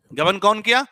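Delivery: loud, high question; loud quiz. Shown as a demonstration. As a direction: fire it at the class.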